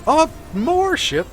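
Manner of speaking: loud, high, drawn-out cry of alarm; alarmed outburst. Real, not acted. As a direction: yelp it out, stretching the words.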